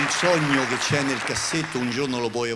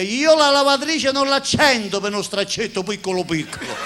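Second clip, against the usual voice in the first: wife voice; 9 semitones higher, 6 dB louder than usual. Acted. Wife's punchline voice.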